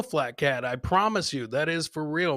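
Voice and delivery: ordinary talk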